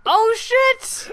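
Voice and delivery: high-pitched astonished exclamation; real surprise. Real, not acted. Cohost hears the character was named Kenny, astonished. Reacts to the name coincidence.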